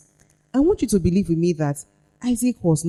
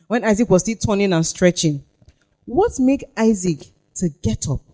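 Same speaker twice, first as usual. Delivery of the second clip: normal talking voice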